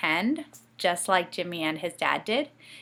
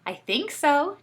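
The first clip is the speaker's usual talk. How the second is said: excited cry; 9 semitones higher, 3 dB louder, slower than usual. Acted. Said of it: "Jimmy cried".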